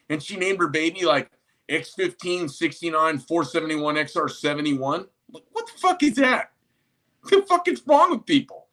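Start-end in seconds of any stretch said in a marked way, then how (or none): 5.3-8.7 much higher, disbelieving what-the-hell; incredulous outburst. Real, not acted. Ranting to viewers about Elon's baby name, pitched way up in disbelief. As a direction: shoot the voice way up in disbelief.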